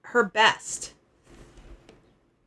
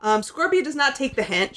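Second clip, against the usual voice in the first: ordinary talk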